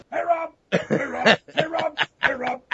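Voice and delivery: high-pitched running-gag greeting; imitating friends' high-pitched hollers; mock calling out. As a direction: repeat it like goofy high calls. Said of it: "they'd be like".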